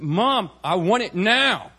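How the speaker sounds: imitated tantrum, slow, high and loud; insistent little boy. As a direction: stretch it out like a demanding kid. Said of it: "a little boy came up".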